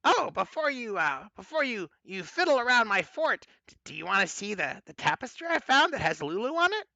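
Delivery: high, fast eager hag voice; Mad Maggie. Acted. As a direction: chirp it like an eager old hag. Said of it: "Mad Maggie says".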